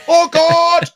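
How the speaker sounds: high-pitched, dismayed cry of shock; acted horror. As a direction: cry out in mock horror, voice shooting up. Acted reaction to sudden tone switch.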